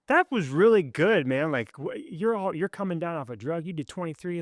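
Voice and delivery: high-pitched, amazed encouragement; retold cheerleading, pitched well up; hyped-up praise. Praising a detox roommate's push-ups, hyped up.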